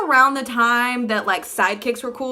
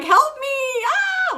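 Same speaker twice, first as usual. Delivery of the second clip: very high hammed-up cry for help; mock panicked plea; 3 dB louder, much slower than usual. Mocking what the girl should have texted. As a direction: ham up a frantic shriek for help.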